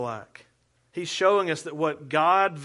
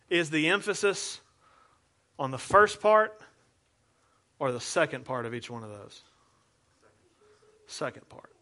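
ordinary delivery

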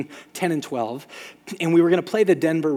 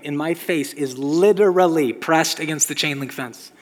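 usual speaking voice

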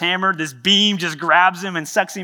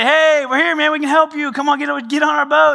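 high, loud call across the water; 11 semitones higher, 7 dB louder than usual. Acted. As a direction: hail him from the boat, up high and loud. Fishing crew calling to stranded man.

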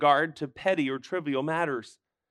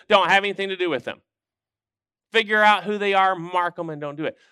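loud, mock tirade; Paul as harsh accuser; 3 semitones higher, 6 dB louder than usual. Preacher acting out what Paul didn't say, loud and hammed-up. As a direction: come in hard, rattling off the charges. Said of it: "Paul didn't come in and with first offense say".

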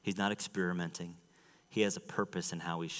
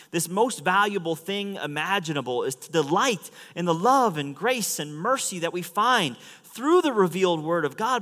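high, loud, driving sermon voice; impassioned preaching; 11 semitones higher, 10 dB louder than usual. Real, not acted. Preacher proclaims God's revelation to the congregation, impassioned preaching. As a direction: preach it out with full force.

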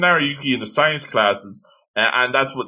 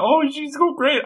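put-on gossipy girls; 10 semitones higher than usual. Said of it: "they're like".

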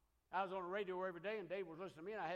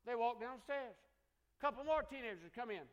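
ordinary delivery

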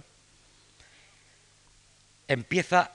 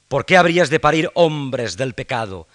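furious shout; 5 semitones higher, 13 dB louder than usual. Acted. Hamlet berates Ophelia.

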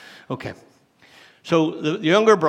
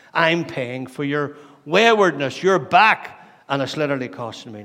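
angry; 6 dB louder than usual. Acted. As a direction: hammer home the grievance as the angry elder brother. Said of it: "the elder brother's angry".

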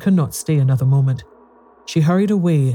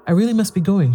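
a hurried excuse, pitched up a little; 6 semitones higher, faster than usual. A narrator's reading. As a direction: say it in a rush to get away. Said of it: "she said hurriedly".

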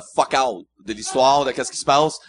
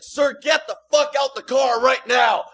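slow, much higher mock yell; officer yelling; 8 semitones higher, slower than usual. Border guard orders him out at gunpoint, yelling.

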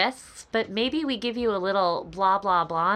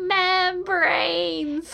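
corny dad joke punchline; 9 semitones higher, 3 dB louder than usual. Acted. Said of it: "It's such a dad joke".

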